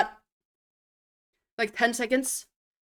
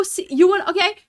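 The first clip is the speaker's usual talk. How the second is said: ordinary talk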